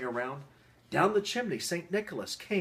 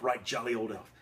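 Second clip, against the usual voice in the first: ordinary delivery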